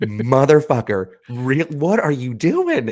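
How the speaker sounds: mock exasperated shout; exasperated yell. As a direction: drag it out in disbelief. Speaker yells at kid.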